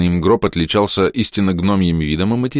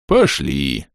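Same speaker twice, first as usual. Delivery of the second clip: усталый вздох; 5 semitones lower than usual. A narrator's reading. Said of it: "вздохнул огромный скелет".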